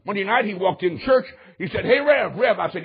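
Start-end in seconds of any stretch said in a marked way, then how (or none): 1.9-2.6 higher, louder hail; retold eager call. Armando hails the preacher in church, eagerly calling out. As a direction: call out eagerly for his attention.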